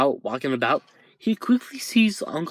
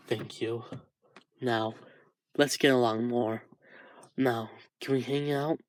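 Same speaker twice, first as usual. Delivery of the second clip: much softer, through tears; tearful thanks; 7 dB quieter than usual. Acted. Izuku crying in Shoto's arms, tearful and soft. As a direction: thank him while still crying. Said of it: "As Izuku cries more and more".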